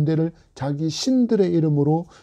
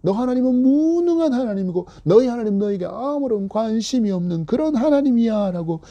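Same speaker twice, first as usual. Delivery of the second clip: higher, sneering jeer, question after question; mocking taunt; 5 semitones higher than usual. Neighbours jeering the exiled Levite, sneering taunt. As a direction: scoff at his faith like a bully. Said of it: "조롱하는 말이".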